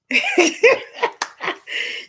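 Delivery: high shrieking cackle. Real, not acted. Cackling at her own joke about stolen husbands.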